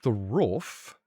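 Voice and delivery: "roof" echoed back in disbelief; puzzled echo. Retold or imitated. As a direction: repeat their roof as if it's strange. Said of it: "And I go".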